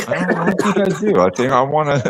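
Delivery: high-pitched, laughing refusal; playfully precious, way up in pitch; tongue-in-cheek decision with a laugh; mock pondering. Jokingly plays someone refusing other opinions.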